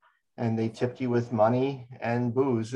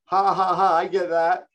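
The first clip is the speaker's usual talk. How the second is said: high, loud booming heckle; booming yell; 7 semitones higher, 7 dB louder, slower than usual. Retold or imitated. Friend heckling a comedian in a silent nightclub, booming yell. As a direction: bellow out a mocking laugh in words. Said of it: "And he yells out".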